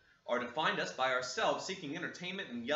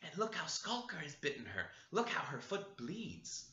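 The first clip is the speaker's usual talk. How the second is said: whispered; 3 semitones higher, 6 dB quieter than usual. Acted. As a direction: say her name under the breath to mother. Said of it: "he whispered to his mother".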